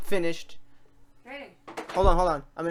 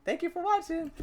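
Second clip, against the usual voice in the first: mock indignant high pitch; 7 semitones higher, 6 dB louder than usual. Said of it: "such a happy response for that".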